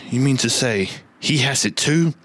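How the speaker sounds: ordinary talk, nothing marked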